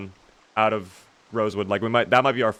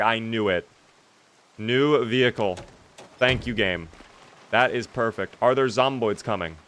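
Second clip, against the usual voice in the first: ordinary talk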